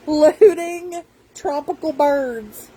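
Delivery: slow words broken by laughter; laughing in disbelief. Real, not acted. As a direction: laugh helplessly through the words. Laughing while telling of accusation.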